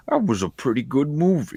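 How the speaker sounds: like a content dad after a film. Retold or imitated. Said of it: "Yep, that's my dad voice".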